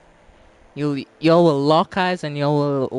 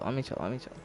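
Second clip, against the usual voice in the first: ordinary talk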